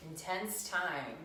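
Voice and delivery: ordinary delivery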